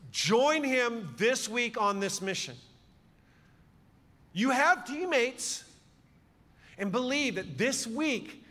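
preaching charge. Real, not acted. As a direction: send them out with slow, ringing emphasis on each phrase.

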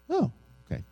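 put-on high little "oh" of a child taking it in; lightly voiced child, pitched well up; retold six-year-old. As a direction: lightly imitate a small child accepting an answer. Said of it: "this is to a six-year-old".

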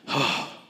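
a long tired exhale; weary sigh. Retold or imitated. Jesus sighs at the crowd's misunderstanding, weary sigh. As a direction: sigh like a man worn out. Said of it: "I imagine he's going".

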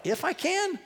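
high, soft incredulous echo; incredulous challenge. Acted. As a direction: toss it back in disbelief. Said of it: "you kinda challenge this father".

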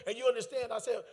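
ordinary talk

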